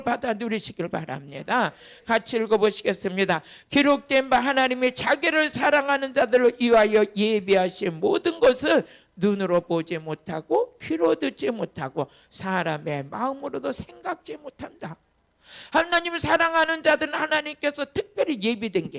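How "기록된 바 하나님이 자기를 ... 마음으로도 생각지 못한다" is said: loud, steady recitation of the verse; loud scripture reading. Acted. Pastor leading the congregation through the verse, loud. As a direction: read it out together, full voice. Congregation reads Corinthians aloud.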